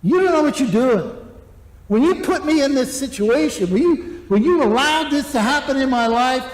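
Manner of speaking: mock accusing complaint to God; grumbling at God. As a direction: whine at God as if wronged. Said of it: "You're basically saying to God".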